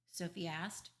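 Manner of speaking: usual speaking voice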